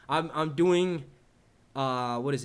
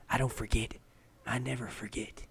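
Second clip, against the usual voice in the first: mock creepy; 3 semitones lower, 4 dB quieter than usual. Streamer teasing a loyal viewer, mock creepy. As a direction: put on a quiet, weird intensity. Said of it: "That was probably weird as shit".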